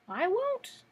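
high-pitched sulky refusal; surly kitten. A narrator's reading. Kitten refusing Dorothy's call to come out, surly. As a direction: pitch it well up, a surly little kitten digging in. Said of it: "answered the kitten in a surly voice".

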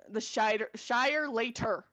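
"Shire Later" slowed, each word distinct; enunciated. Shown as a demonstration. Correcting a listener who heard Shire Lair, enunciated. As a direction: spell out "Later" slowly with a clear T. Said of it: "I can enunciate".

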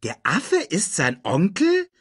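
high-pitched, incredulous rising question; astonished. A narrator's reading. Timon questions Nala about Simba's uncle, astonished. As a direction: end high in sheer disbelief. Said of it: "Timon war sehr erstaunt".

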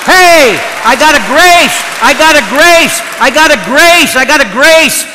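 high, loud acted-out excitement; excited outburst. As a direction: call it out loud and high, phrase by phrase. Excited retold outburst to a sister.

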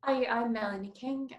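ordinary talk, nothing marked

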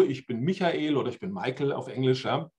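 normal talking voice

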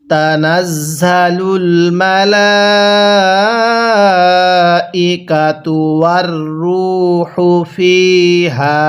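slow, drawn-out melodic tilawat; Quran recitation. Acted. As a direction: recite with long, measured vowels. (ধীরে সুর করে কুরআন তিলাওয়াত)

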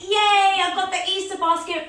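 excited cheer. Acted. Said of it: "Reach your basket".

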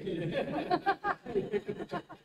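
a burst of high laughter at the memory; amused high laugh. Real, not acted. Pastor laughing while telling the congregation the story. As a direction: laugh out loud, high and bright.

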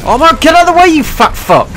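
very high, loud outburst; exasperated yell. Real, not acted. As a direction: scream at someone in your way. Yells at a player blocking him.

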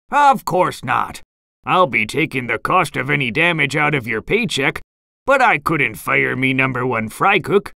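plain reading voice

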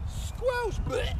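squelch squeaked out high; playful high squelch for the kids; high squeaky squelch. Pretend. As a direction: pop the word up high and squeaky. Teacher squelches through pretend mud.

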